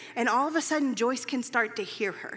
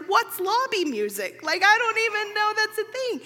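higher, louder, tearful wonder; healed woman in the lobby, higher and amazed; amazed question; 9 semitones higher, 4 dB louder than usual. Retold or imitated. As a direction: marvel at a sound she's never known. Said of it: "She was like".

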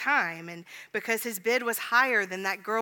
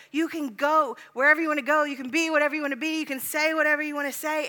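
higher, louder, fervent promise; 5 semitones higher, 6 dB louder, faster than usual. Acted. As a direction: lift it up with conviction. Voicing Jesus' promise, rising.

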